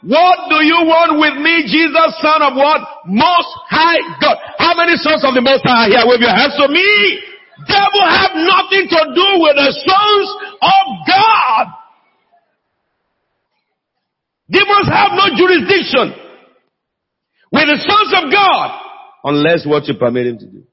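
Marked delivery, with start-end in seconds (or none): 0.0-4.4 high, drawn-out cry of a demon; demon's shout. Acted. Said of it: "He shouted at the top of his voice". 4.6-18.7 high, impassioned preacher's cry; full-force preaching. Real, not acted.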